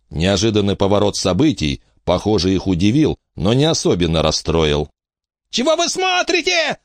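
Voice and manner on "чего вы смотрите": very high-pitched squeal; wailing yell. A narrator's reading. Frankie yelling at onlookers for help, high wail. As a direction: shriek it high without extra volume. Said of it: "завопила Фрэнки".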